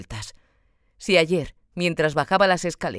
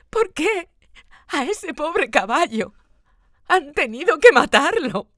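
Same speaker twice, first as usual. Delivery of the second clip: put-on high, louder child voice breaking into sobbing cries; 11 semitones higher, 7 dB louder, slower than usual. A narrator's reading. Boy cries out to his father about the horse, high louder sobs. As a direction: wail it out through sobs, gasping. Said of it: "dice entre sollozos".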